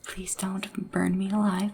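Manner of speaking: ordinary talk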